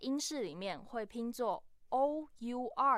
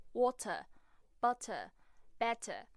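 British accent. Shown as a demonstration. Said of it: "在英国腔里面会发出特别的声音".

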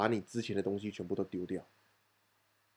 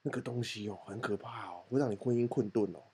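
hushed gossip; 6 dB quieter than usual. Retold or imitated. Gossip told to a classmate.

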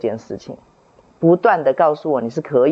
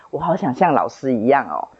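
ordinary talk

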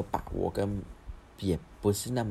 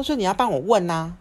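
higher, louder whiny demand; demanding mom; 10 semitones higher, 8 dB louder than usual. Retold or imitated. Mom insisting the kids ask for her, loud whiny demand. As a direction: push it like an impatient mother.

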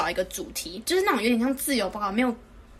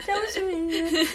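very slow, higher, whiny mock drawl with a laugh; mocking whiny classmate; 6 semitones higher, much slower than usual. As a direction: stretch the excuse out in a whiny mock voice. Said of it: "什么". (慢慢拉长声音学同学说交不出来)

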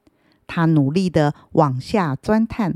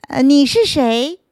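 timidly asked; 7 semitones higher, 5 dB louder than usual. Acted. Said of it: "他怯生生地问".

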